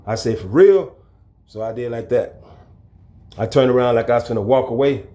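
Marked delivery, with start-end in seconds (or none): 0.4-1.0 incredulous. Retold or imitated. Incredulous challenge.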